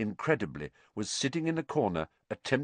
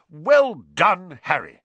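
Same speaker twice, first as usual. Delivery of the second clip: high, loud start, falling on the name; delighted; 6 semitones higher, 9 dB louder, slower than usual. A narrator's reading. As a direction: congratulate heartily. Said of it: "looking delighted".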